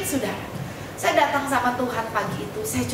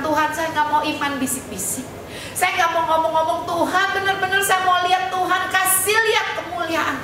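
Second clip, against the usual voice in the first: fervent prayer; 8 semitones higher than usual. Retold or imitated. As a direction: build from earnest to a high, intense plea. Fervent retold prayer building.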